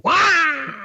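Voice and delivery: a sudden high, loud squeal; mock high yelp. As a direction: yelp out like a goosed man. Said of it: "Make 'em go".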